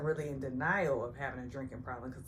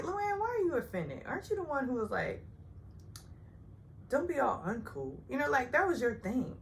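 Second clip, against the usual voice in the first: mock retort; 9 semitones higher than usual. Speaker retorts to Luann in thought.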